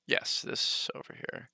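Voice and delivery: whispered aside; whispering. Real, not acted. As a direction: whisper it off mic. Whispered aside to someone off camera.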